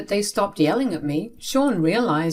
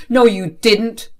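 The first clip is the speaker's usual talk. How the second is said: loud, higher, flatly contradicting; angry contradiction; 4 semitones higher, 7 dB louder than usual. A narrator's reading. Angry father denying child's excuse, loud contradiction. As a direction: shoot the excuse down loudly. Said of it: "Dad was very angry".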